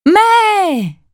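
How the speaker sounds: high, loud cry; shout. A narrator's reading. Girl running toward her mother, shouting out loud.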